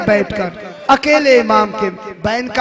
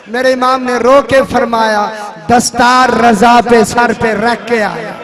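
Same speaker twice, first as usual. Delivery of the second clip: loud, rising majlis lament; preaching at full force; 5 dB louder than usual. Acted. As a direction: cry it out to the mourners. Preacher builds to weeping climax. (पूरे ज़ोर से मजलिस का बयान)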